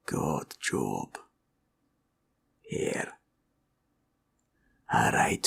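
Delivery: ordinary talk, nothing marked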